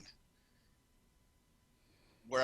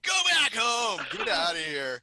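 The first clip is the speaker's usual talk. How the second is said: mock yell; 5 semitones higher, 5 dB louder, slower than usual. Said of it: "he's telling Inga's mom".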